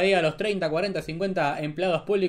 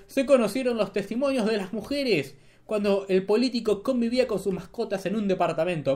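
normal talking voice

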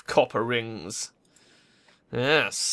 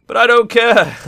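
belts it out, louder and higher; loud mock-callous declaration; loud gleeful outburst; 11 semitones higher, 13 dB louder than usual. Real, not acted. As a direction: shout that you don't care, delighted. Gleeful dismissal after moral musing.

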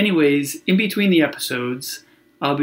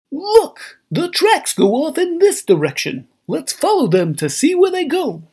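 much higher, louder eager cartoon voice; cartoon hero voice; 12 semitones higher, 4 dB louder than usual. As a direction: call it out like an excited cartoon kid. Cartoon hero spots slime tracks.

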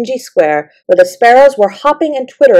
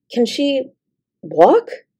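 hushed question broken by a pause; soft, halting, breathless wonder; 5 semitones higher, 4 dB quieter, much slower than usual. A narrator's reading. Girl asks whether the doll can walk, breathless. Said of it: "she asked breathlessly".

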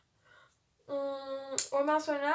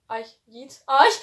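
normal talking voice

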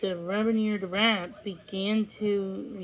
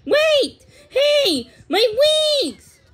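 very high, loud cries; piercing panicked yells; screaming; 8 dB louder, slower than usual. A narrator's reading. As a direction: scream after them in separate bursts. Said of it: "who was screaming".

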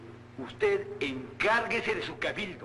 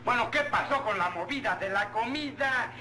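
usual speaking voice

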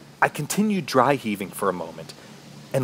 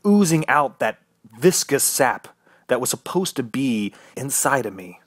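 ordinary delivery

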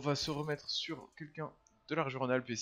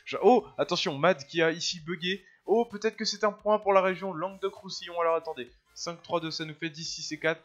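excited; 5 semitones higher, 6 dB louder than usual. Real, not acted. Tense finish in live commentary.

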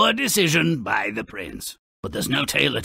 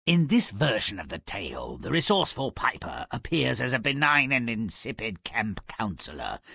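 normal talking voice

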